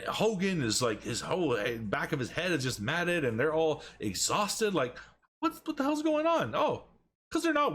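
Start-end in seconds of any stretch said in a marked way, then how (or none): 5.4-7.7 much higher, disbelieving squeal; mock disbelief. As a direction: act outraged and stunned, pitched way up. Mock disbelief at lazy wrestlers.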